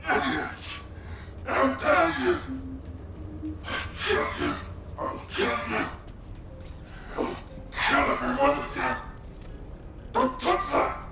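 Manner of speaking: breathy, half-voiced raging threats; furious ghostly voice, broken into short bursts; enraged raving. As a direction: spit out threats in a ragged whispery rage. Distorted enraged voices of colossus recordings.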